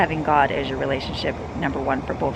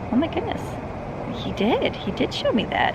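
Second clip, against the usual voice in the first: amazed realization; 9 semitones higher than usual. Retold or imitated. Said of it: "and I'm like".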